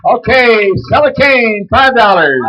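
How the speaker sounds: high, loud call to start; loud opening call. Real, not acted. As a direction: shout out the starting bid. Starts the next auction loudly.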